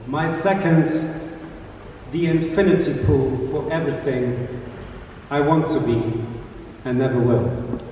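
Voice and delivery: slow, measured poetry reading; spoken-word performance; poem recital. Acted. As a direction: let each line land with pauses.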